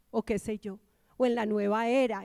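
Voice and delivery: ordinary delivery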